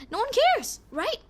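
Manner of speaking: sarcastic high dismissal; mocking. Real, not acted. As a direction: squeak it out mockingly. Mocking squeak.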